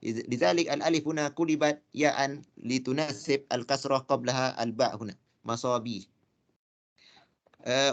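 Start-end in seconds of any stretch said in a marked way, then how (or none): none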